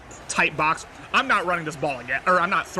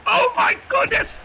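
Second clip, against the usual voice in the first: much higher, slow, drawn-out call; thrilled play-by-play yell; 13 semitones higher, slower than usual. Real, not acted. Fan calls his team's interception, drawn-out excited yell. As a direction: scream the pick like a stadium announcer.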